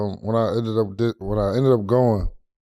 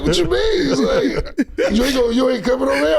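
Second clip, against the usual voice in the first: laughing retelling. Retold or imitated. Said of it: "I was like".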